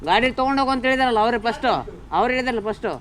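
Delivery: loud, real argument; angry arguing. Real, not acted. Rider insists the others spoke first, angry and loud. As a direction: throw the blame back loudly. Heated quarrel over entry.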